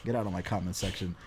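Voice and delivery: low, quiet deadpan putdown; retold comeback. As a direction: deliver the insult low and unbothered. Retells his comeback to a commenter.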